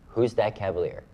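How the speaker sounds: ordinary talk, nothing marked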